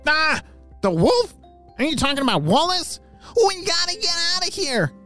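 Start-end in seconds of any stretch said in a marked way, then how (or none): 3.4-4.9 high, panicky character voice; alarmed otter. Acted. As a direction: pitch it way up in alarm. Otter alarmed at news of wolf.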